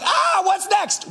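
high, slow excited call; a newly baptized believer, thrilled and eager; eager excitement. Pretend. Fresh from baptism water.

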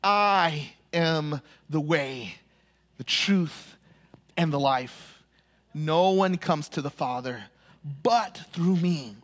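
slow, low and measured, each phrase set apart; solemn declaration. Acted. Jesus declares himself the way, slow and solemn. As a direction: let every phrase land with weight. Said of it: "Jesus declares".

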